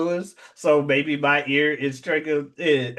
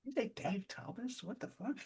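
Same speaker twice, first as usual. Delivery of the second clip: very soft, higher-pitched incredulous question; hushed disbelief; 10 semitones higher, 18 dB quieter than usual. Retold or imitated. People quietly doubting the name Dave Thomas, hushed disbelief. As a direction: ask it under your breath, baffled.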